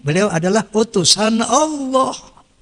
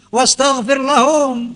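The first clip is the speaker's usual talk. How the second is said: slow chanted Arabic, lahum drawn out; Quranic recitation; 3 semitones higher, slower than usual. Acted. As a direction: intone the verse reverently. (lantunan ayat perlahan)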